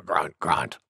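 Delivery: boar grunt. Acted. Wild Boar after her big sneeze, low soft grunts.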